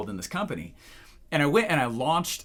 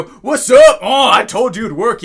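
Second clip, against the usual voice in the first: shouted, high told-you-so; on the phone with the CEO, shouting in triumph; gloating shout; 7 semitones higher, 15 dB louder, slower than usual. Retold or imitated. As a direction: crow at the CEO. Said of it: "I'm on the phone with the C E O".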